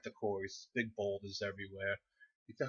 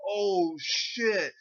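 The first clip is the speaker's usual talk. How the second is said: loud, high drawn-out reaction; amused shock; 10 semitones higher, 7 dB louder than usual. Retold or imitated. Watching it hit Scotty in the face, shocked delight. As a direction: exclaim it like watching a direct hit.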